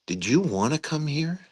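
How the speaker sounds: puzzled question. Retold or imitated. Puzzled general questioning an officer at dinner, incredulous. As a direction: ask it baffled, pitch lifting at the end. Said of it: "with a puzzled look in his eye".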